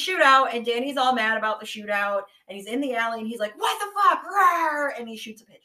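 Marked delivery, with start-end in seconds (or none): 3.6-5.0 angry outburst. Retold or imitated. Danny furious in the alley.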